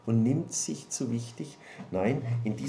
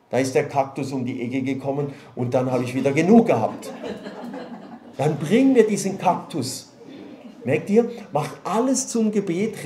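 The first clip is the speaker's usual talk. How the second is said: raised, pressing sermon voice; impassioned pulpit delivery; fervent preaching; 4 semitones higher, 5 dB louder than usual. Acted. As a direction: preach it out with full force to the congregation. Preaching at full force.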